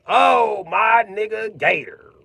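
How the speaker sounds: high, loud, slow shout of greeting; excited greeting. Retold or imitated. Greeting the YouTuber Gator in his chat, a high excited shout. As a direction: hail a friend across the room, loud and slow. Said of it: "I be like".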